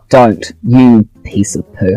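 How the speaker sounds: ordinary talk, nothing marked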